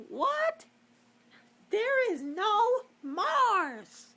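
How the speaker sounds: high, loud, slow, cracking outrage; outraged shout. Real, not acted. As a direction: yell it in disbelief. Outraged disbelief at missing Mars.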